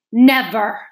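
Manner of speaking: loud, angry one-word denial; furious. A narrator's reading. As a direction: burst out in indignant fury. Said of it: "said the queen furiously".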